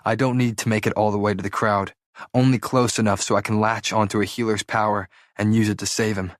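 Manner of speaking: ordinary delivery, nothing marked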